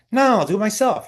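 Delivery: imitated curt refusal, high and loud; abrupt. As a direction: brush off the helper sharply. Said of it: "it was just very abrupt".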